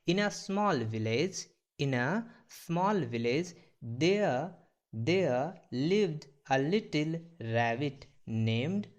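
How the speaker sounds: very slow, word by word English reading; slow model reading. Shown as a demonstration. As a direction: read it out slowly for the class to repeat. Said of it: "क्या बोला जा रहा है sentence में देखिएगा". (धीमा, शब्द-दर-शब्द पढ़ना)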